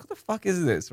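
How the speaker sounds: high, incredulous voice of an annoyed local; mock local's reaction. Locals fed up with entitled tourists, high incredulous mock. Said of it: "They're like".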